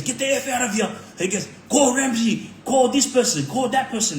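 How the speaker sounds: retold rant. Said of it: "He was going off".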